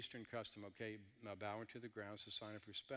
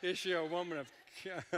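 higher, louder, breaking into laughter; laughing; 6 semitones higher, 6 dB louder than usual. Real, not acted. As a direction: talk through laughter at your own joke. Cracks up at own joke.

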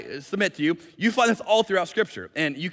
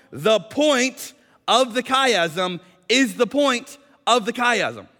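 very slow, higher, each "point" pressed; slow emphatic; 4 semitones higher, 3 dB louder, much slower than usual. Shown as a demonstration. Preacher spelling out the thesis to the congregation, slow and emphatic. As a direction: spell it out slowly.

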